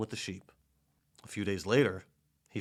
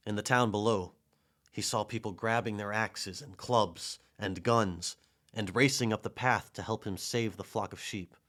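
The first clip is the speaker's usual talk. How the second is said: plain reading voice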